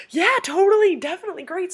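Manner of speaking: loud, high, eager agreement; imitating an effusive yes; enthusiastic yes. Actor happily agreeing to photo.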